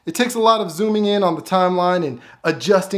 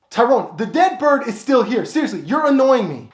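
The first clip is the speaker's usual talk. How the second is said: raised, higher, fed-up complaint; annoyed Matthew; 7 semitones higher than usual. Acted. Matthew complaining to Tyrone about the bird, annoyed. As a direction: complain to your roommate, fed up.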